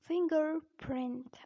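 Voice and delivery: slow American "fingerprint", both Rs sounded; American accent. Shown as a demonstration. Teacher showing learners the American fingerprint, American accent. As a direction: draw out an American R in 'fing-gerr-print'. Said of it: "But in American accent, we say it".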